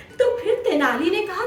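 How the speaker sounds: ordinary delivery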